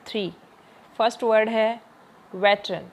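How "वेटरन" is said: Veteran stressed on वे; veteran, hit the first syllable वे. Shown as a demonstration. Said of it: "starting में ही आ गया stress". (Veteran में वे पर ज़ोर)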